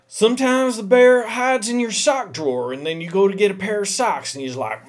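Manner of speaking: ordinary talk, nothing marked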